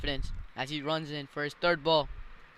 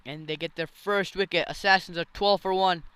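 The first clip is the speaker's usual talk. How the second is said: excited, loud wicket call; cricket commentator; 5 semitones higher, 8 dB louder than usual. Real, not acted. Wicket falls, live call.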